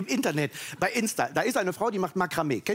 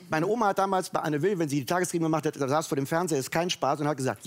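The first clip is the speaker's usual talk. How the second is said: ordinary talk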